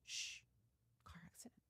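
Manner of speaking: hushed, breathy conspiratorial hint; conspiratorial whisper. Retold or imitated. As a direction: whisper it like a secret wink. Therapist hushes, coaching her story.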